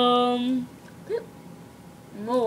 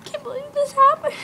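fast and squeaky, pitched way up; high squeaky voice; 12 semitones higher, faster than usual. Acted. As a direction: rush it out in a squeaky voice. First take of acted line.

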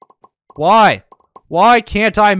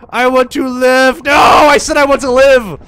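desperate shout; 7 semitones higher, 7 dB louder than usual. Real, not acted. Player insisting again to the attacker, full loud shout. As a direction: scream it louder because nobody listened. Player repeats the plea, louder.

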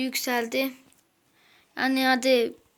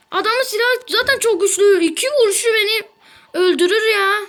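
loud frustrated; 7 semitones higher, 6 dB louder than usual. Real, not acted. Heated mid-fight complaining.

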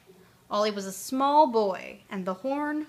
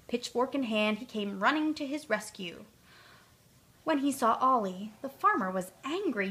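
ordinary delivery

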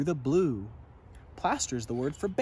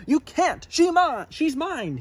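high, loud angry roar; Zog roaring; 13 semitones higher, 5 dB louder than usual. A narrator's reading. Zog roaring at the knight, high and loud. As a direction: claim her fiercely. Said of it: "he roared".

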